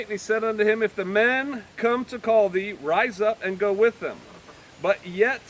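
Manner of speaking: measured, lifted Bible reading; solemn King James reading; scripture reading. Acted. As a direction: read the verses with gravity. Reads scripture aloud.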